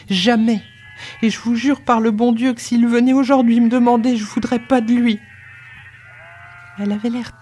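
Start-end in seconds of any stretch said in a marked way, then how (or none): 0.1-5.2 high-pitched, insistent protest in one run; vehement oath. A narrator's reading. Servant girl swearing she would refuse Jacques, vehement. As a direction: swear it with desperate conviction.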